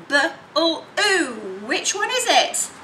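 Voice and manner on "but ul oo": "but-ul-oo" drawn out slowly; segmented blue. Shown as a demonstration. Teacher modelling blue for pupils. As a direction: stretch blue into sounds. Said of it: "which is the but-ul-oo".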